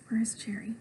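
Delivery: unvoiced whisper to the listener. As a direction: breathe it like a secret. Secret whispered aside.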